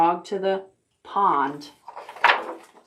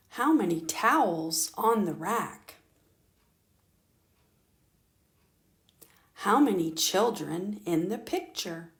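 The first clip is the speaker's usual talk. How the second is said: soft counting prompt; 7 dB quieter than usual. Shown as a demonstration. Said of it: "we're gonna see how many".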